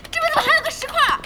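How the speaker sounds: fast high frantic shout; frantic cry. Acted. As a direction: yell at the obstacle in alarm. Boss panicking while gaming. (慌乱地高声叫喊)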